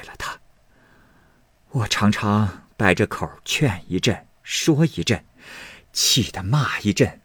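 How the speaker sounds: a mother pouring out her worry to a trusted maid; grieving Lady Wang. Acted. (压低声音、哽咽诉苦的王夫人)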